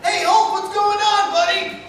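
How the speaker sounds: very high, loud and eager; energetic Human Torch. Retold or imitated. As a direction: shoot way up into a hyper hero voice. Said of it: "the Human Torch is up here".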